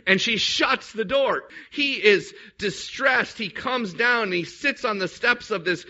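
ordinary talk, nothing marked

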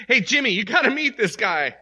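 loud, high, cheerful grandpa call. Retold or imitated. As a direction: call the grandson over with joy. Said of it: "grandpa says to Jimmy".

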